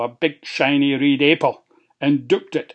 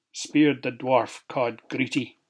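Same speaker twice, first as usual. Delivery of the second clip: usual speaking voice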